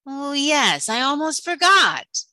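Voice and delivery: loud, high, theatrical afterthought; devious sea witch. Acted. Sea witch springing a catch on Ariel, devious. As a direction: feign suddenly remembering, devious and big. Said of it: "The sea witch smiled deviously".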